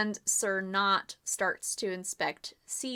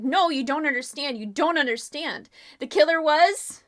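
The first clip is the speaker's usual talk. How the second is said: loud, higher, urgent protest; desperate pleading; 5 semitones higher, 8 dB louder than usual. Acted. James pleading with the circle, loud and urgent. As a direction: beg them to listen.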